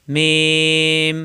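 মিম stretched long, ending in a closed-lip hum; lips closing again. Shown as a demonstration. Teacher demonstrating lip closure, drawn-out articulation. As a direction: part the lips, then seal them on মিম. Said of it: "মিলানো ঠোঁট ছেড়ে দিয়ে আবার মিলাতে হবে".

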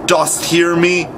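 slow, high calling question; drawn-out summons to a spirit. A narrator's reading. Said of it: "Hook raised his voice".

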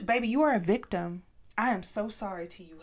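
ordinary talk

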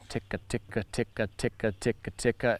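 slow, soft ticka, ticka; tongue out ticka, ticka slowly and softly. Shown as a demonstration. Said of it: "So start real slow".

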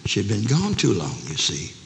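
ordinary talk, nothing marked

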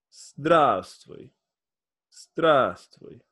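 Здравствуй said quickly, the v nearly dropped; quick natural Russian hello; natural speed. Shown as a demonstration. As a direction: say Здравствуй like a native. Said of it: "Together make".

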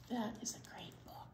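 a quick hushed whisper. Real, not acted. Teacher confiding to the children about the book, whispering. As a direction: let it slip out fast under your breath. Aside after finishing story.

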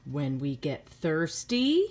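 ordinary delivery, nothing marked

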